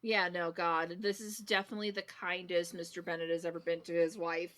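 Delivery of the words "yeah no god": ordinary talk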